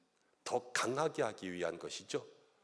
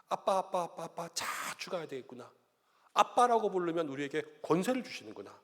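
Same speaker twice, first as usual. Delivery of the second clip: higher, fervent calls that end in a loud cry; child calling dad, rising to a shout; calling Abba; 8 semitones higher, 7 dB louder than usual. Acted. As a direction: cry out to the father with longing. Crying out to God as dad.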